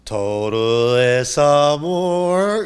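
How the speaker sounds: very slow, drawn-out bliss; blissful slow. Retold or imitated. Demons at peace after the exorcism, slow and blissful. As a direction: stretch it out like a sigh of peace.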